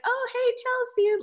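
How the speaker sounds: high, drawn-out call of hello; mock yell across. Stranger yelling to her across the parking lot, calling out. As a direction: imitate someone calling over from afar. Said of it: "like, yelling across at you".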